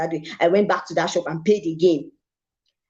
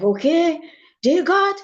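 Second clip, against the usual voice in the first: excited thanks; 9 semitones higher, slower than usual. Retold or imitated. Kneels thanking God in shop.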